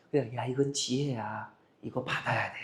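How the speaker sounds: a soft sweet-talking persuader; gentle persuasion. Retold or imitated. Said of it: "부드럽게 얘기하는 사람이 아닙니다".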